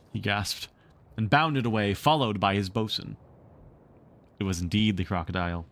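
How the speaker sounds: usual speaking voice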